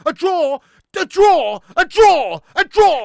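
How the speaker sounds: shouting in disbelief; angry shout. Real, not acted. Refusing a draw, shouting.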